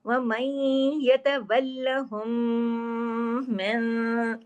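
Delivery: very slow chanted recitation; Quran recitation. Shown as a demonstration. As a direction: recite with idgham, drawn out. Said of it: "इधर क्या हो रहा है". (धीमी तजवीद वाली तिलावत)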